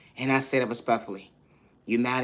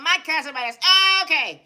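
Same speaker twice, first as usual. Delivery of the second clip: squeaky mock voice; 4 dB louder than usual. Squeaky mock version of catchphrase.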